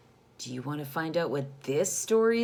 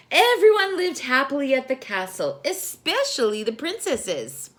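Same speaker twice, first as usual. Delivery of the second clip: storytelling sister; 7 semitones higher, 8 dB louder than usual. Acted. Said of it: "continued Kendra".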